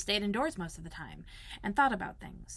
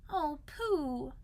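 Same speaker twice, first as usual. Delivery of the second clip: crying out; 7 semitones higher, 5 dB quieter than usual. Acted. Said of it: "cried Christopher Robin".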